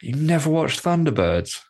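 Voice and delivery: high-pitched disbelief; incredulous. Real, not acted. Co-host learning his friend never watched Thunderbirds, incredulous. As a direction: ask it as if scandalised.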